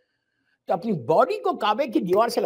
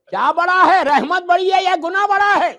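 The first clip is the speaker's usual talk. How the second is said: louder, higher challenging question; raised voice; 8 semitones higher, 9 dB louder than usual. Acted. Imam Ali raises his voice to shake the sinner. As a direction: raise your voice to jolt him. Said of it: "اپنی آواز کو تھوڑا سا raise کیا".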